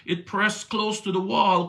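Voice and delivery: usual speaking voice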